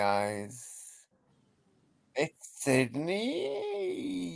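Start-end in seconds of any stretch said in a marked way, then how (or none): 2.2-4.3 high, very slow sing-song reveal; high drawn-out mock announcement. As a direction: stretch it out like a grand unveiling.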